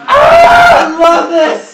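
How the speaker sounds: high, very loud, drawn-out cheer; excited shout. Real, not acted. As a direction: burst out at full volume with delight. Player delighted by the story twist.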